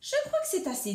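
high-pitched, lightly voiced satisfied shark; shark speaking. A narrator's reading. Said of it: "dit Requin en brandissant son sac".

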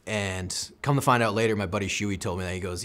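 ordinary talk

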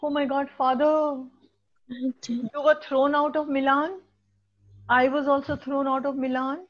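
teacher voicing Miranda's sad apology to father; mock Miranda. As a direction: play her as a grieving girl to her father. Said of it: "She says".

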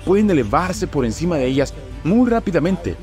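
ordinary talk

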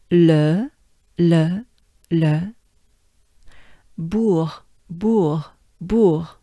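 "le" and "bourg" one at a time, with pauses; isolated French syllables "le" and "bourg" for repeating; broken into syllables. Shown as a demonstration. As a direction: drill "le", then "bourg", in careful French. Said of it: "let's break it down".

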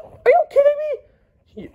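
high, loud shocked yell. Acted. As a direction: shriek it in disbelief. Shocked at poison plot.

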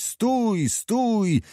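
high, falling cry to stop; distant shouting. A narrator's reading. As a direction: call a halt from far down the road. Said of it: "i krzyczeć".

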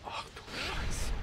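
high breathy slow whisper of dismay; dismayed breathy gasp; shocked whisper. Real, not acted. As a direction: whisper it in horror. Sees a strong enemy form.

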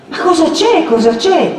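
high, urgent repeated question; insistent alarm. A narrator's reading. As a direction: press hard for an answer. Agnese insists, grabbing her arm.

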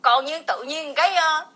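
usual speaking voice

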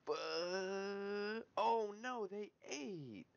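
high, drawn-out cry of frustration; dismayed wail. Real, not acted. Gamer seeing the target eat, dismayed wail. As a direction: wail as the win slips away.

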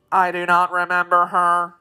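robotic monotone; mechanical machine man. A narrator's reading. Tik-Tok puzzling over the princess, robotic monotone. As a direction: speak on one unchanging note.

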